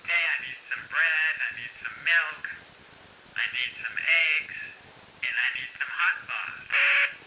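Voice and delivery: a shopping list squawking from a tiny speaker; played back from the device. Shown as a demonstration. Husband at the store replaying his shopping list, tinny playback. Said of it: "you wanna make sure you get every item".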